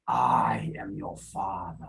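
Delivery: low, slow breathless Vader whisper; hushed Vader menace for the students; whispered Darth Vader. Shown as a demonstration. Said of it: "my Darth Vader impersonation".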